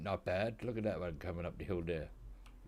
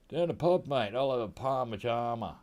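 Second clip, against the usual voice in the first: mock Aussie bloke; 4 semitones higher, 6 dB louder than usual. Mock Aussie pub talk.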